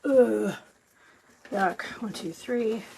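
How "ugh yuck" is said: ordinary delivery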